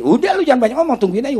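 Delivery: high, insistent dismissal; retold impatient brush-off. Kiai telling his caller to wait, high and impatient.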